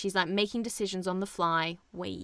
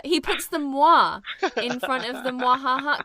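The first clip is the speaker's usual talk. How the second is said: ordinary delivery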